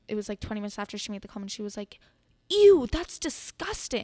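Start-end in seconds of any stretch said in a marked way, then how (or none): 2.5-4.0 loud, high, drawn-out mock disgust. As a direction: recoil in revulsion, imitating her. Retells dancer's disgust at belly button.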